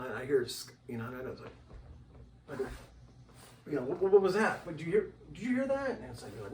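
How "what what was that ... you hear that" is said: high, urgent, fast questions; startled at a sound; imitating his own alarm; startled what was that. Retelling hearing a voice.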